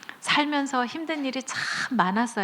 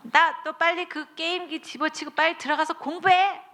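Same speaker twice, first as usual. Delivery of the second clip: higher, sharp scolding mother; angry mom scolding; 6 semitones higher than usual. Retold or imitated. Mother scolding her child over a game console, angry. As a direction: nag the kid to go study. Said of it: "엄마들이 막 화납니다".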